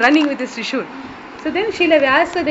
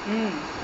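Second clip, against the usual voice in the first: listening hum; 7 semitones lower, 13 dB quieter than usual. Shown as a demonstration. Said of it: "Means you're hearing".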